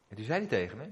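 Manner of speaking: ordinary talk, nothing marked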